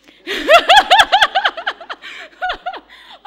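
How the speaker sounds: high, loud laughing fit; loud burst of laughter. Real, not acted. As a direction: break up laughing. Laughing at own joke.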